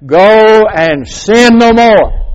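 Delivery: loud, slow and drawn out, each word weighed; slow solemn command. Acted. Preacher booming Jesus' words to the congregation, slow and solemn. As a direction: proclaim it like a final charge.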